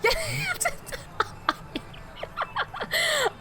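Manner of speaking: a high, breathy burst of laughter. Real, not acted. Laughing at the clip of her and her friend. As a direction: crack up at the video.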